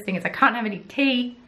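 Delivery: ordinary delivery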